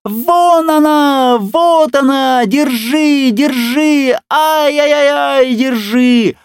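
very high, loud panicked squeal; shrieking squeal. A narrator's reading. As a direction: shriek like he's being slaughtered. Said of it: "завизжал так, как будто его режут". (пронзительный визг медвежонка)

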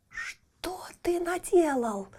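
startled high whisper; exclaiming. A narrator's reading. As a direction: gasp it out in alarm. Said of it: "воскликнула фея".

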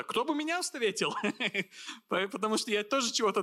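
amused rhetorical question with a laugh. Real, not acted. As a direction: laugh through the question at yourself. Jokes about being greeted at airport.

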